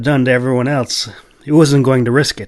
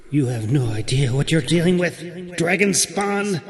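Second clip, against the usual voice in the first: cursing unicorn; 4 semitones higher, 4 dB quieter than usual. A narrator's reading. Unicorn cursing the Clove, menacing. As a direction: curse him like a furious creature. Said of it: "the unicorn cursed".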